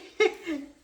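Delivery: high, loud happy laugh; pleased with the deal, a loud delighted laugh. Real, not acted. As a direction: let out a gleeful laugh. Laugh after the story.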